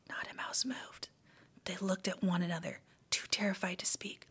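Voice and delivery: soft unvoiced whisper; whispered narration. A narrator's reading. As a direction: hush the room as you read. Tense silent moment.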